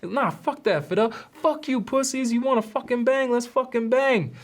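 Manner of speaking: imitating a hothead begging for a brawl; hyped aggression. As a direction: egg on the fight like a hothead. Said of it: "Alex keeps pushing to fight".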